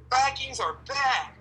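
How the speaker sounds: high, slow, hammed-up Minnesota mom cheer; Minnesota mom accent. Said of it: "thickest Minnesota accent".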